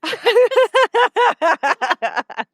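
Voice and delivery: laughing at her own telling; high burst of laughter. Real, not acted. Speaker cracks up.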